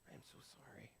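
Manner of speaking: whispered, mock sorry; fake-sympathy whisper. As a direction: breathe it out like false pity. Acted fake sympathy to friend.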